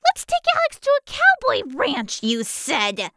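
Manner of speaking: very high mocking kitten voice; squeaky sulky mimicry of the aunt; grousing. A narrator's reading. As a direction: throw the words back, grumbling. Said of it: "Riley groused".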